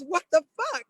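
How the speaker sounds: imitated high-pitched disbelief; incredulous outburst. Postal worker friend questions the officer's stop, incredulous.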